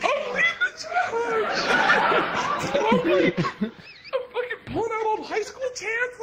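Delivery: very high, strained comic yelling; worked-up squeal. Acted. Self-made leader recounting his charge, high strained shouting. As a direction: squeal it out, overexcited. Worked up boasting about leading.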